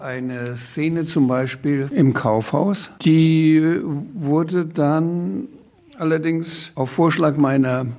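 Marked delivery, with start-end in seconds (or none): none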